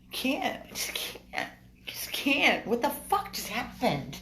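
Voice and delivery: high, overwhelmed outburst; distressed. Real, not acted. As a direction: blurt it out, shaken and upset. Shaken after puppy scratched them.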